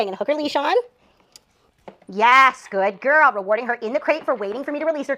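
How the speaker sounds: normal talking voice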